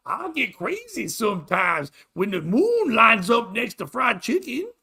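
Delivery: high, loud mock-Irish accent. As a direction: put on a loud, drawn-out Irish voice. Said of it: "That's my Irish voice".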